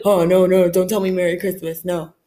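low, put-on objector voice, loud at the start; mock refusal. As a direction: imitate a pompous killjoy turning down a greeting. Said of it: "I'm not gonna be like".

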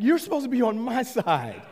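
high, comically wounded complaint; indignant protest. Retold or imitated. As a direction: demand loyalty in mock outrage. Retold protest to his wife.

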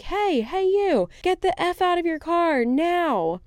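high, drawn-out mock shout; imitated screaming. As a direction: call out slowly like the yelling stranger. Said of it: "He starts screaming at me, shouting stuff".

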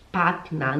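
Partner as "patna", low and falling; careful model of Partner ending in -NA; light final A. Shown as a demonstration. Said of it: "Você puxa um leve A".

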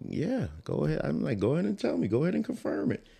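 high-pitched, retold eagerness; eager go-ahead. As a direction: act out confidently urging someone on. Acts out his impatient past self.

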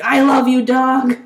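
loud friendly yell. Retold or imitated. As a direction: shout it with love. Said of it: "the way I yell".

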